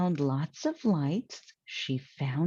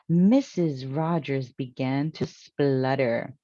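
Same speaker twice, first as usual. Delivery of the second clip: usual speaking voice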